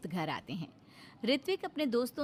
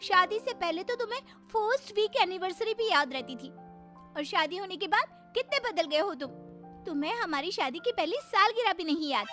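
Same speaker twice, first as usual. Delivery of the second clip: higher-pitched, aggrieved scolding; upset wife; 9 semitones higher than usual. Acted. Wife to husband over the forgotten anniversary, upset. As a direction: reproach him as a let-down wife. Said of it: "इस तरह से प्रीति नाराज होकर".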